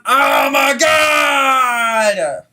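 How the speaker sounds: long drawn-out exasperated cry; frustrated shout. Real, not acted. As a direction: yell it out in disbelief. Frustrated shout at bad play.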